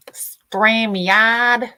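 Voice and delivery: loud, drawn-out "StreamYard"; scolding shout. Real, not acted. Streamer telling off StreamYard for messing with her, scolding. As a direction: call out the software's name in loud mock reproach.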